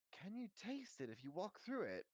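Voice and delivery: high-pitched curious question; curious boy asking. A narrator's reading. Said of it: "Harry asked him".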